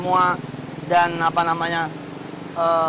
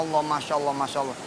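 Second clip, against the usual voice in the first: much softer, lower marvelling Masya Allah; preacher hushed in amazement at God's gift; hushed awe; 6 semitones lower, 8 dB quieter, faster than usual. Acted. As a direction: breathe it out in quiet wonder.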